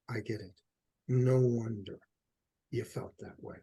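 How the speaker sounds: soft, low and tender, with pauses; compassionate reassurance. Acted. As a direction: soothe a hurt child, letting the voice sink. Voices soothing a wounded child.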